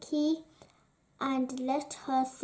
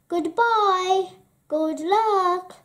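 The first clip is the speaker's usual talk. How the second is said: higher, louder send-off; cried farewell; 5 semitones higher, 6 dB louder, slower than usual. A narrator's reading. Princess to departing Gerda, calling out farewell. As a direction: call out goodbye, raised and lifted. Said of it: "cried the princess".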